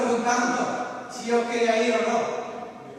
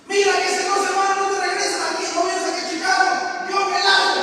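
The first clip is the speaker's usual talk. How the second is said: higher, louder, fast threat; brother's ultimatum; 8 semitones higher, 6 dB louder than usual. Retold or imitated. Brother on the phone threatening to leave Chicago, fast and raised. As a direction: snap out the warning he'll leave.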